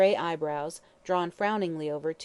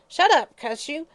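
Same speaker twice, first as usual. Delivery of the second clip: much higher, loud furious yell; vicious yell; 9 semitones higher, 8 dB louder than usual. Acted. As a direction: scream her down in rage. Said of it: "yelled Mother Guttersnipe viciously".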